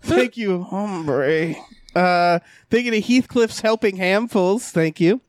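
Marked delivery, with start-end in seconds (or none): 0.0-1.5 dry calm rasp; grizzled voice. Acted. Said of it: "in a grizzled voice".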